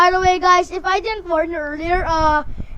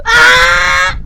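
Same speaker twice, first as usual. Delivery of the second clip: distorted shout; 9 semitones higher, 11 dB louder than usual. Real, not acted.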